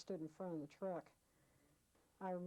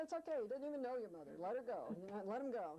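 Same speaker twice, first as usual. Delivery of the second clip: higher, coaxing reassurance; retold soothing; 4 semitones higher, faster than usual. Coaxing the woman at the truck to let go of the guy, soothing. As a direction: gently talk her into letting him go.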